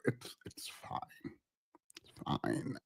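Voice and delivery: very low, hushed, trailing away; resigned mutter. Real, not acted. Host dropping the vocal fry argument, resigned and very quiet. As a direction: let it go under your breath. Drops the argument after a sigh.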